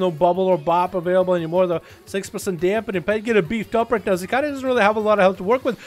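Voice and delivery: excited esports caster calling the duel; hyped duel commentary; live play-by-play. Acted. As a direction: call the fight live as it happens.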